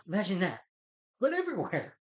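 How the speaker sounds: hammed-up excited boast; excited quip. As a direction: voice climbs high and loud. [name]'s joke, rising high.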